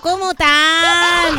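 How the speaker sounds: drawn-out exasperation; exasperated. Real, not acted. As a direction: stretch every syllable in disbelief. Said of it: "Agradece que no puedo decir malas palabras".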